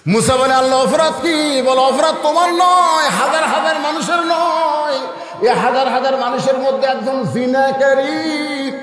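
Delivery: high, drawn-out accusing chant; full-force preaching. Acted. God tells Musa of the sinner, high impassioned preaching. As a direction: thunder out the sins in long ringing phrases.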